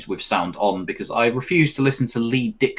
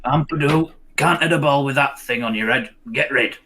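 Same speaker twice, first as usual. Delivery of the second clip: mock pundit voice; 4 semitones higher than usual. Mock commentator line, laughing after.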